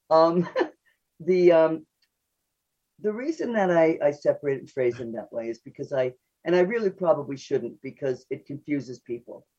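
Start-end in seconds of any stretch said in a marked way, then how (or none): none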